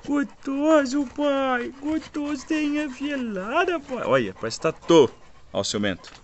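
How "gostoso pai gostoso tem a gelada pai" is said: very high, slow squeaky dog voice; a blissed-out pup on the cold ground; voicing the dog. Father speaks for his dog in the cool dirt, very high and slow.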